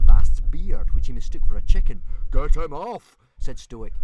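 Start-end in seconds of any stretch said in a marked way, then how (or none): 2.3-3.2 raised, higher voice; alarmed order. A narrator's reading. Stoic with a dragon on his beard, raised alarmed order.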